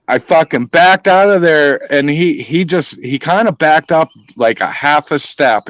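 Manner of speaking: usual speaking voice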